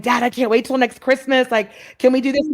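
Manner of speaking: bubbling over, voicing a younger self; excited child. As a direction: imitate an eager child begging dad. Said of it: "excited that she was more excited than me".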